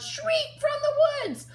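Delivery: high, slow, drawn-out shriek; monster shriek. A narrator's reading. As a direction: let out a creature's scream. Narrator voices monster shriek.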